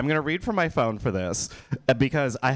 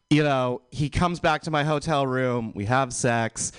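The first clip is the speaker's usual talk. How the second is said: ordinary delivery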